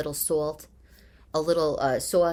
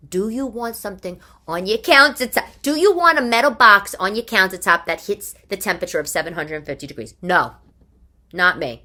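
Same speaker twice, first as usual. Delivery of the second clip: louder, pushing, then flat refusal; emphatic rant; 2 semitones higher, 8 dB louder than usual. Real, not acted. Host ranting to listeners about a hot countertop oven, louder. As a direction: hammer the question home.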